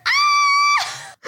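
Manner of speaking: high shrieking mock scream. Sister imitating brother's startled scream. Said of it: "And you start".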